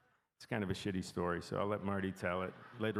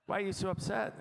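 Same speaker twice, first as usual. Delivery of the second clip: imitated teammate asking in a much higher pitch; mock puzzled question; 12 semitones higher, 3 dB louder than usual. Teammate across the card table asks pouting friend, imitated high-pitched puzzlement.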